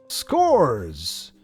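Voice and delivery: normal talking voice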